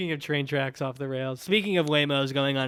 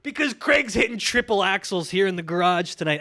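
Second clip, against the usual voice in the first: high, loud hammed-up commentator shout; mock sports commentary; 8 semitones higher, 5 dB louder than usual. Host calling Craig's stretch as Olympics, excited mock commentary.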